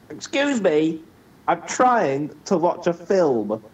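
high, loud, slow prim complaint; prissy mock complaint. Friend mocking him shushing a cinema couple, high, loud and slow. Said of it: "And you're like".

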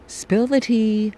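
high, slow gossipy demand; eager gossip voice. Pretend. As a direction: beg for the juicy details. Voicing an eager gossiping listener.

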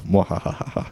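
whispered evil laugh. Pretend. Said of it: "I'm gonna say it every time".

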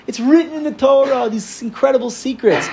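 high, fast, pushy objection; indignant protest. Retold or imitated. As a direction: argue back that it was all holy secrets. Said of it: "So what does Yid say?".

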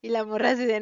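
normal talking voice

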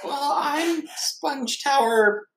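high, slow cartoon sponge voice; Sponge Tower. Improv player introducing his sponge character, high cartoon voice. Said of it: "You have to think of an improv character".

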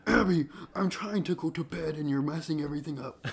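high exasperated scolding; scolding the cat. Retold or imitated. Telling the cat off at bedtime, high exasperated voice. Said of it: "It's like".

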